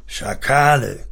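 slow growl through gritted teeth; understated growl. A narrator's reading. Father cursing the vanished children, understated growl. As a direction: stretch the insult out, teeth pressed together. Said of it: "knurrte Uri mit zusammengepressten Zähnen".

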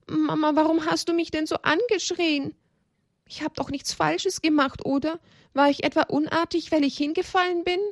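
put-on high-pitched, upset little child's voice; sobbing child. A narrator's reading. Child asks Mama why she screamed, acted tearful high voice. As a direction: plead up high like a hurt small child. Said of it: "Schluchzend brachte er nur heraus".

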